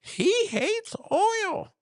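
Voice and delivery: high, slow, whimpering mock oil man; oil guy from The Jerk. Streamer imitates the oil guy warning everyone away in a high whimper. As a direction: wail it like a weepy crank in a comedy. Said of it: "like the oil guy from The Jerk".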